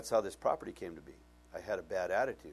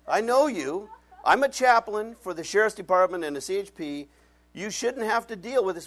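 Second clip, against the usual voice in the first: high, loud pleading; guilty protest; 9 semitones higher, 10 dB louder than usual. Retold or imitated. Pastor pleads with the officer, high and loud. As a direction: insist you're guilty.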